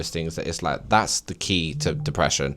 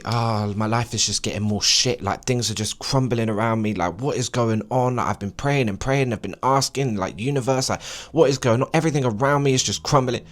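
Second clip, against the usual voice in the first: higher, rising complaint; whiny complaining quote; 4 semitones higher than usual. Retold or imitated. Vlogger imitating despairing viewers, high and whiny. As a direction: whine like someone losing hope. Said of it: "So when people say".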